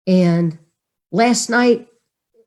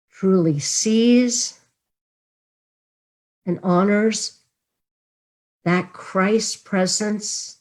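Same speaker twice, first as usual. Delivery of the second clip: closing blessing; 3 dB quieter than usual. Acted. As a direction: bless them gently as you close.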